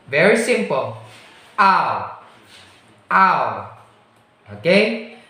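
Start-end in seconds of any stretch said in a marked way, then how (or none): 1.6-3.8 "Ow" gliding from a to u; drawn-out teaching "Ow"; diphthong demo. Shown as a demonstration. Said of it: "the sound of that one is ow".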